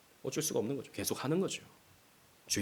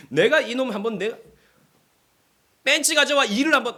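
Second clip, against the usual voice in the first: mock revenge threat; 8 semitones higher than usual. Acting out revenge like a movie.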